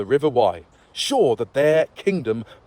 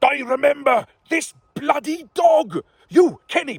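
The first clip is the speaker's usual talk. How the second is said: higher shout at the dog; king recognises the dog and shouts; 13 semitones higher than usual. Acted. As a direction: call the dog out.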